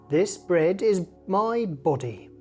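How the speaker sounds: ordinary delivery, nothing marked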